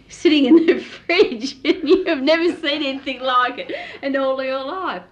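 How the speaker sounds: high, loud, breaking into laughter; laughing while talking. Real, not acted. Daughter telling of a live chook in fridge, laughing. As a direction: tell it through real laughter.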